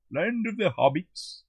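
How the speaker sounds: high, slow, drawn-out delivery; hammed-up repeat. Midlander joking about Middle Earth, hammed-up and high. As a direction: stretch it out like a grand announcement.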